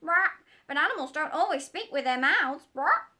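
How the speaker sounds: high, loud squawking parrot. Acted. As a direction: squawk it like a know-all parrot. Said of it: "said the parrot in a high voice".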